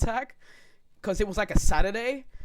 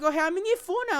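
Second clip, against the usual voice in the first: put-on kid's voice; 9 semitones higher, much faster than usual. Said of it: "I'm like".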